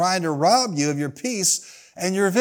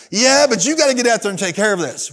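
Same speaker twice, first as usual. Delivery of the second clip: raised, pressing objection; mock protest, pitched up; retold insistent protest; 4 semitones higher, 3 dB louder than usual. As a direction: push back as a worked-up complainer. Retold member insisting again.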